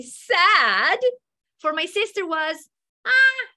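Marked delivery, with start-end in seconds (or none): 3.0-3.4 high, loud brush-off cry; dismissive scoff. Retold or imitated. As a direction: wave it away with a loud scoff. Sister shrugging it off.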